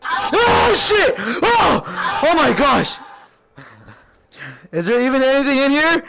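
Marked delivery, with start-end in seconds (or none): 0.0-3.0 startled cries. Real, not acted. Sudden scare in the game.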